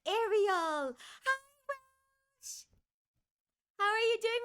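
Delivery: high, bright squeal of hello; high-pitched hi to a friend; excited greeting. Real, not acted. Greeting a chat viewer.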